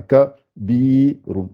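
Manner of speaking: "ka, bi, run" in separate syllables, "bi" held long; lengthened i. Shown as a demonstration. Teacher showing ya lengthens the i, drawn-out "bi". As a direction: stretch the i of "bi" between short "ka" and "run". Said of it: "il peut allonger que le i".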